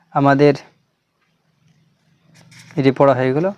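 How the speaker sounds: ordinary talk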